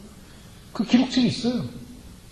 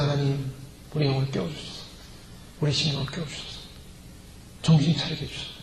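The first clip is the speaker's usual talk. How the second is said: hushed prayer; 7 semitones lower, 9 dB quieter than usual. Acted. Acted prayer for awakening.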